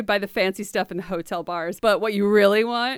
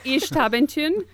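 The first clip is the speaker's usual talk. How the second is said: higher attempt at a Mayan word, slipping into German; accidental German accent; 6 semitones higher, 4 dB louder than usual. Real, not acted. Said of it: "pronouncing it like it's German".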